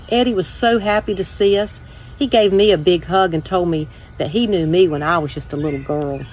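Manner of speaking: usual speaking voice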